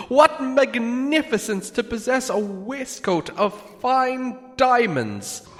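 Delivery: high, fawning hobbit voice; flattering hobbit. Acted. Bilbo flattering Smaug's armor, high and fawning. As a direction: lay on the flattery.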